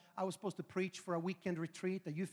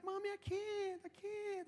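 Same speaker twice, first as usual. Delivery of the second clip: put-on high, drawn-out toddler whine; whining child; 10 semitones higher, 7 dB quieter, slower than usual. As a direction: plead softly like a whiny little boy. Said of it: "the whining got stronger".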